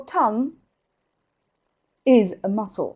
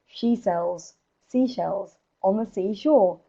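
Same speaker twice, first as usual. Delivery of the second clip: slow, carefully separated words; careful tongue twister; 6 dB quieter, slower than usual. Shown as a demonstration. Coach modelling a tongue twister to repeat, slow and careful. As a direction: take the tongue twister slowly, phrase by phrase. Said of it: "you could try this".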